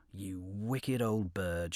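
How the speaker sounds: slow, low threatening crab; menacing, drawn-out threat; angry crab. Acted.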